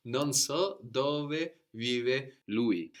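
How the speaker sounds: Non so dove vive lui drawn out word by word; very slow model sentence. Shown as a demonstration. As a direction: spell it out slowly for the student. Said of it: "very slow model".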